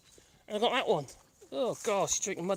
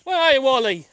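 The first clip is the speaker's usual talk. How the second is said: loud, raised searching call; calling the dog; 5 semitones higher, 10 dB louder than usual. Real, not acted. Owner searches for lost Wally, loud calling. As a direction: shout out for a missing dog.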